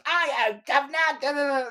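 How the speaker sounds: very high, cartoonish Cockney; mock Cockney. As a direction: gabble a caricature Cockney accent. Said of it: "like, the Cockney".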